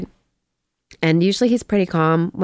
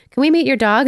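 mom asking; 7 semitones higher, 4 dB louder than usual. Retold or imitated. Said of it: "And then Mom is like".

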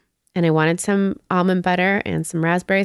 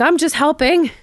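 indignant rich man; 9 semitones higher, 3 dB louder than usual. Retold or imitated. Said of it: "He's like".

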